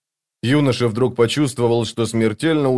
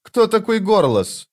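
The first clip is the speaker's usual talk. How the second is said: slow, high-pitched stern question; menacing demand; 11 semitones higher than usual. A narrator's reading. Thief questions his captive about a rival, menacing demand. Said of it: "грозно глядя на девушку".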